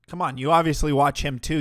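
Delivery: ordinary talk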